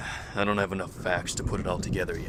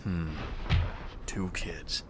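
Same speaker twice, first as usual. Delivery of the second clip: whisper; 4 semitones lower, 6 dB quieter, slower than usual. Acted. Skeptical repeat under breath.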